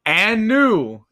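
drawn-out championship announcement; ring announcer. Pretend. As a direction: announce the new champion, stretching each word slowly. Announces a new wrestling champion.

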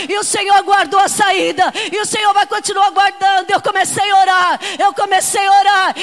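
loud, fervent preaching cry; full-force preaching. Acted. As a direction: preach it to the congregation with fire.

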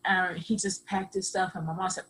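ordinary talk

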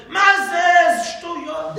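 high, drawn-out, indignant shout; shouting objection. Retold or imitated. Students heckling their rabbi mid-shiur, shouting. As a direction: bellow each word slowly at the teacher.

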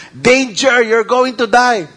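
mock warning shout. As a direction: shout the danger at them. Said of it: "People can actually shout in front of you".

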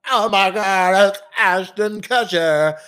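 usual speaking voice

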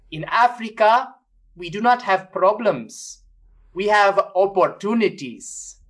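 imitating an African bishop's accent at the mic, slowly. Retelling a bishop's speech at the Vatican in an African accent. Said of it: "Was that accent really African?".

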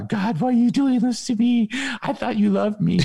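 mock self-pity, squeaky and high; wounded whine. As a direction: plead like a wounded child. Voices a hurt believer.

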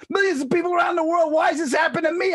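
high, loud self-pitying rant; mock screaming. Friend lamenting his luck by phone, mock screaming. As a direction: imitate a friend in hysterics. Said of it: "He's screaming and yelling".

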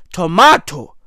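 "tomato" as to-MAH-toe; British tomato. Shown as a demonstration. Comic arguing for English, "tomato" as to-MAH-toe. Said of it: "what's more English than tomato".

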